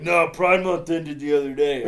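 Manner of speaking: slow, loud imitation of a friend; loud mock retort. Storyteller imitating Jason in the Disneyland bathroom, loud retort. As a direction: announce it loudly with laughter creeping in.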